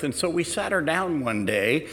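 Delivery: normal talking voice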